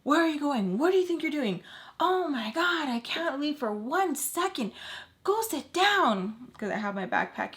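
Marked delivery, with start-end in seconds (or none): 0.0-6.5 high-pitched exasperated scolding; worked-up mock yell; imitating his yelling. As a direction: mimic an angry telling-off, voice pitched right up. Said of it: "immediately yells at me".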